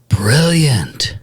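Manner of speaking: gasp, then whisper it; hushed, breathy amazement on an intake; awed whisper. Real, not acted. Hushed awe at the explanation.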